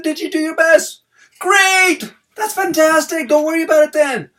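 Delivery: high, loud, gushing praise; excited praise. Retold or imitated. Dad praising his daughter's effort, high and loud with excitement. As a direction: pump her up with big enthusiasm.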